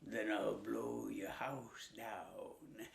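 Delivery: low, soft, slow wolf threat; a wolf growling his threat; low slow menacing wolf. Acted.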